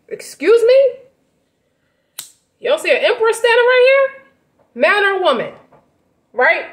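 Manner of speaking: a mock-royal, sharp high protest; offended emperor. Acts offended emperor being slapped.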